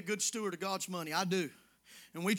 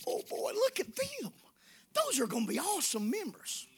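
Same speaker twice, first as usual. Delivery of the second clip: higher, put-on delight at newcomers; mock eager welcome; 8 semitones higher than usual. Pastor mocking how he greeted newcomers at the back door, mock eagerness. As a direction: play up the false excitement. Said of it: "I did this".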